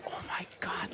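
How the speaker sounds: hushed, whispered disbelief; retold gasp of realisation, under the breath; stunned whisper. Retelling own stunned private reaction.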